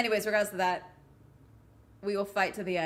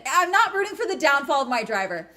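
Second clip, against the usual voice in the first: defensive; 6 semitones higher, 6 dB louder than usual. Real, not acted.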